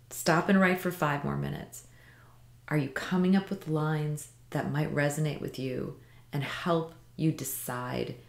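ordinary talk

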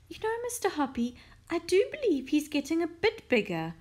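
a lady chatting up to her neighbour, lightly excited; Mrs Silver, curious. A narrator's reading. Missus Silver asking Mister Hoppy's view, curious and high-pitched.

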